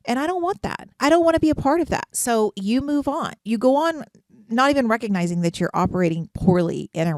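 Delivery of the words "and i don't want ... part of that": higher and faster, a mock adamant refusal; firm refusal. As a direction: insist hard that you want none of it. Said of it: "and you're like".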